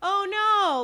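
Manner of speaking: high, hammed-up exclamation; fake dismay. Woman covering for the vanished genie, fake dismay. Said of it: "when she does the fake thing".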